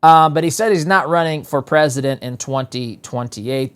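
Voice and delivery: normal talking voice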